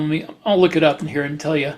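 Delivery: usual speaking voice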